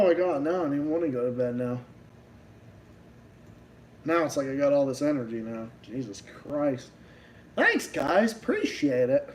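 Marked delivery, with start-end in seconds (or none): none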